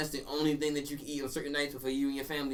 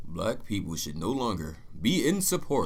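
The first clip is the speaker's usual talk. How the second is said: slow, formal announcer read; 3 semitones lower, slower than usual. Pretend. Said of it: "What is this voice?".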